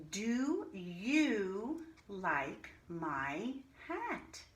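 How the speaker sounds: very slow "Do you like my hat?" ending on hat; teacher reading a question slowly. Shown as a demonstration. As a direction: read it slowly, word by word, for the children. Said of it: "she asks a question".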